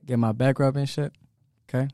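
ordinary delivery

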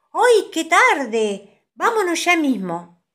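high, loud, dismayed cry; alarmed hurry. A narrator's reading. Child seeing it is late and must go home, alarmed. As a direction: cry out that time has run out.

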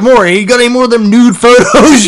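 loud high New York leer; New York accent. Retold or imitated. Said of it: "I made him New York".

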